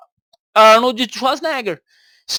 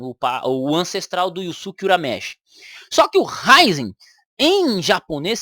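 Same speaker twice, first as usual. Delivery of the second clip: ordinary talk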